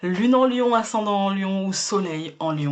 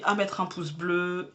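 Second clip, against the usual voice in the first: ordinary talk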